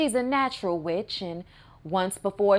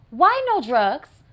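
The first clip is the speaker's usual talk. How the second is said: exasperated; 4 semitones higher, 4 dB louder, slower than usual. Real, not acted. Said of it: "rising exasperated repeats".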